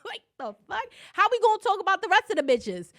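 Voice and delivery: high, loud, fast talk through laughter; laughing. Real, not acted. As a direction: laugh it out, barely getting words through. Laughing at friend's answer.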